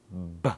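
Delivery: B with lips together and a little explosion; B sound. Shown as a demonstration. Teacher showing learners how lips make B. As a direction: close the lips and burst the B. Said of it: "you must put your lips together".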